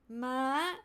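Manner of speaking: "ma" lifting from mid to high. Shown as a demonstration. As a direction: raise "ma" upward. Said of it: "these are the five Thai tones".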